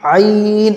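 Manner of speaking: "आइन" from the throat, with a long held vowel; pronunciation demo. Shown as a demonstration. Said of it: "इस तरह से". ("आइन" हलक से खींचकर)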